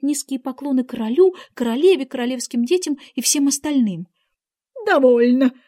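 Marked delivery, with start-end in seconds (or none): 4.9-5.5 mild shriek, loud and sharp. A narrator's reading. Queen stops the bowing gardeners, mildly shrieking. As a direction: cut them off with a loud cry. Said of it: "взвизгнула королева".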